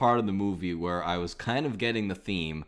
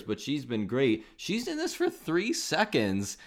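ordinary talk, nothing marked

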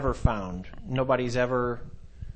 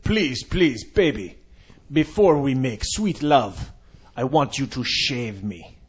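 sexy voice; 3 dB louder, slower than usual. Pretend. Mock phone sex voice.

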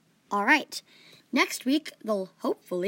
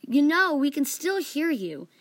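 louder, slow irritation; irritated; 5 semitones higher, 5 dB louder, slower than usual. A narrator's reading. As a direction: draw it out, annoyed. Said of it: "Jihiri said with irritation".

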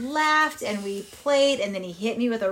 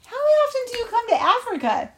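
much higher, incredulous storybook question; astonished question; 13 semitones higher, 3 dB louder than usual. Acted. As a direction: ask it in high-pitched amazement. Narrator questions Frank, surprised.

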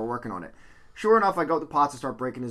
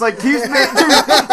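usual speaking voice

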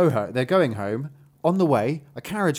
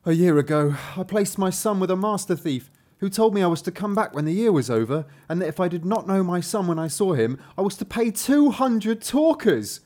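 higher, earnest character voice; troubled Hans, a worried father; 3 semitones higher than usual. Acted. Father telling a dwarf about his lost son, troubled. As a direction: lay out your plight with emphatic woe.

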